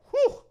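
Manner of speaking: sharp high whistling exhale; whew. Real, not acted. Streamer reacting to the close-up shooting video, shocked high whew.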